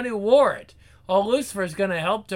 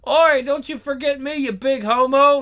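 rowdy heckling shout; 6 semitones higher, 6 dB louder than usual. Acted. Heckler shouting at talk show.

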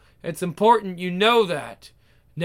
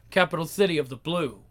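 ordinary talk, nothing marked

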